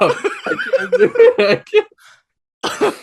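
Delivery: laughing. Real, not acted. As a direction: barely get the words out laughing. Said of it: "I'm gonna throw up".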